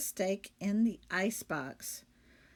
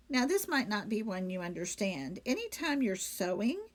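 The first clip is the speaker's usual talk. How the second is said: ordinary delivery, nothing marked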